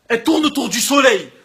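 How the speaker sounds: usual speaking voice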